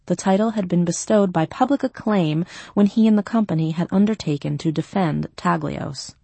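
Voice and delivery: ordinary delivery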